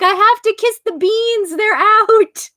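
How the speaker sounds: can't help it, must kiss them; gushing over beans. Retold or imitated. Quoting herself about the cat's paws, in a high giddy gush. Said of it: "I'm like".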